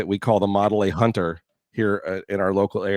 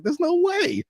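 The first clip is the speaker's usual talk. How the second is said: way higher mock disbelief; retold disbelief; slower than usual. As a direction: refuse to believe it, pitched up. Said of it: "And I'm like".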